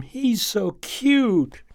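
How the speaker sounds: high, slow, doting coo; a girl bending down to coo at a puppy. Acted. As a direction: melt over the puppy.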